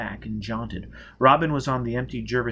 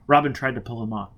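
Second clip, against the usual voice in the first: ordinary delivery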